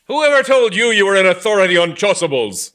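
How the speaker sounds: loud, high indignant demand. Acted. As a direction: demand it furiously. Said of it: "demanded the vicar".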